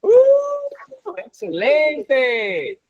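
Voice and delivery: high squealing "Ooh" then a slow drawn-out "excelente"; delighted ooh. Real, not acted. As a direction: gush over the student's win. Celebrating Sandy's win.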